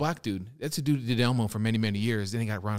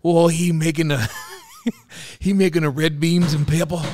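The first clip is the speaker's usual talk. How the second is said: hammed-up Cajun gator voice, getting louder; Louisiana accent; 4 semitones higher, 4 dB louder than usual. Playing his bayou gator puppet for laughs, Louisiana accent. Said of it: "Give me a Louisiana accent".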